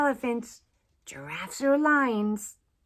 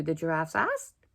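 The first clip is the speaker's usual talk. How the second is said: plain reading voice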